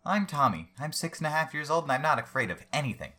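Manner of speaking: put-on squeaky kid bragging; little boy voice. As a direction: play a proud six-year-old. Child character voiced.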